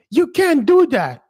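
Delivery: very high, loud whiny brat; spoiled boy king shouting; imitating Joffrey. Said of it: "and be like".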